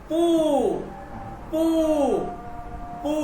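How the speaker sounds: long, stretched "পু"; "পু" drawn out as pooo; long drawn-out vowel. Shown as a demonstration. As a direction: hold the oo in "পু". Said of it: "এই ধরনের লম্বা একটা টান দিবেন".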